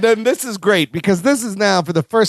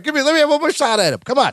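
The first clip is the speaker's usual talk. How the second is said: fast, high straining plea; hammed-up straining to get at him; mock eager plea; 9 semitones higher, faster than usual. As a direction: rush it, pitched high. Larry held back, wanting more.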